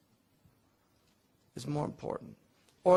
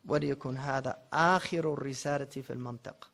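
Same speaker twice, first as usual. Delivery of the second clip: brisk, measured recitation of a classical text; scholarly Arabic read-aloud; formal Arabic text reading; much faster than usual. Acted.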